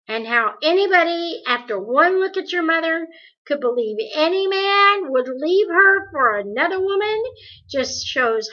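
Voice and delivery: Calvin insisting to Meg the rumor is false, forceful; insistent reassurance. Acted. Calvin calming upset Meg.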